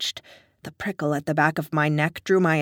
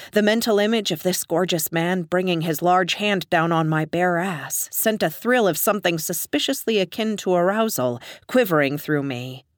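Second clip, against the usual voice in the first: ordinary delivery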